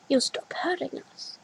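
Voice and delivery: soft whisper; whispered hope. A narrator's reading. Little girl tells her older self, whispered. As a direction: breathe it gently. Child's hopeful closing whisper.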